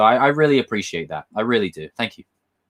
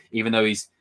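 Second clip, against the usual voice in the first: ordinary delivery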